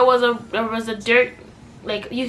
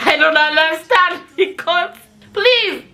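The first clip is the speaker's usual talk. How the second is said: laughing through the words, then a high please; cracking up in disbelief, please shoots up high; 7 semitones higher, 6 dB louder, slower than usual. Real, not acted. Laughing at the boyfriend's sister excuse.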